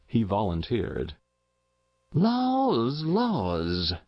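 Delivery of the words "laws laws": high first word falling away; country woman's pitying sigh; dismayed sighing. A narrator's reading. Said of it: "sighed Missus Race".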